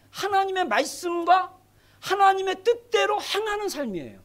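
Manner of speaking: normal talking voice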